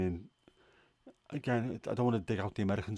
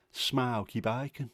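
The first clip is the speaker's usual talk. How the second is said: slow Brummie "smoky bacon"; Brummie accent; 3 semitones higher, slower than usual. Shown as a demonstration. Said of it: "sound better in a Brummie accent".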